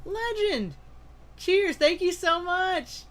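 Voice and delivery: high, thrilled thanks; excited thanks. Real, not acted. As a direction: still buzzing with gratitude. Thanking after gifted memberships.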